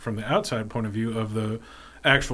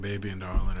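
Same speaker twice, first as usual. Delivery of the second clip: fake Cajun accent; 4 semitones lower than usual. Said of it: "I can do multiple fake Cajun accents".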